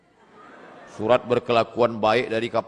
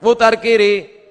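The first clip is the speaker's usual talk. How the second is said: high, loud mock order to turn; examiner's command; 9 semitones higher, 9 dB louder than usual. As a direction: bark out the examiner's instruction. Meniru perintah penguji. (meniru penguji memberi perintah, tinggi dan keras)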